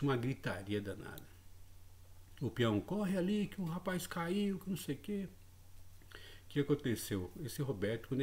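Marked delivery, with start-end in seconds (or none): 2.8-5.3 calling out. Retold or imitated. Farmhand calling for help.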